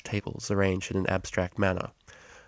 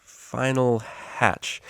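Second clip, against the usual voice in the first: hesitant, halting small mare, a touch higher; nervous; 4 semitones higher, much slower than usual. A narrator's reading. Shy student introduces classmate, nervous and hesitant. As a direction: stumble timidly over the name. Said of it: "a small mare said nervously".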